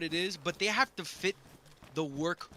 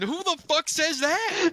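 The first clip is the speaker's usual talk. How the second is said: high, loud incredulous manager; manager's outburst; 12 semitones higher, 9 dB louder than usual. Retold or imitated. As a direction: blurt it out loudly like a baffled boss. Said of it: "then my manager goes".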